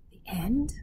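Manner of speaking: a scared, hushed echo of the question; frightened whisper. Acted. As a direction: whisper it back with a shiver of fear. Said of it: "Lena felt a shiver of fear".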